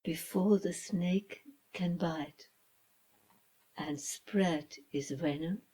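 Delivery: ordinary delivery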